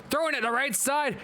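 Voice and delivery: ordinary talk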